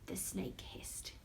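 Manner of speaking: hushed hissing narration; whispering. A narrator's reading. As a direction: hiss it softly like a snake. Snake hiss described.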